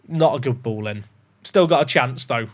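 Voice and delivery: usual speaking voice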